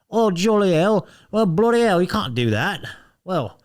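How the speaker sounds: camp it up as a flustered Brit finger-wagging at a killer; mock British accent. Mocking the UK campaign's polite response, in a hammed-up British accent. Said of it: "that was Australian".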